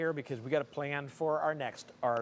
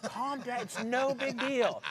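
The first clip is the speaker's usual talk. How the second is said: higher, slow calming voice; soothing wife; 8 semitones higher, slower than usual. Retold or imitated. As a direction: gently reassure him it's fine. Wife soothing him over broken thing.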